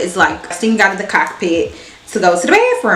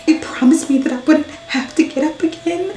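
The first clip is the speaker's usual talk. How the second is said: higher, whiny mock sob; mock crying lady; 7 semitones higher than usual. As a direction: whimper it like a crybaby. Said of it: "she's like".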